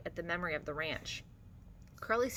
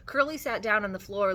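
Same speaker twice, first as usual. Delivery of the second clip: usual speaking voice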